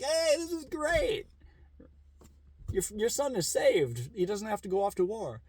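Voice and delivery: high mock-joyful villager voice; mock villagers rejoicing. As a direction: celebrate like relieved neighbours. Villagers cheer the son saved.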